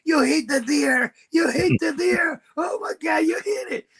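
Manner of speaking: high-pitched panicked yell; mock yelling. Owen yelling at the driver in panic, high shrill yell. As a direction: shriek it like a startled passenger. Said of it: "He, he started yelling".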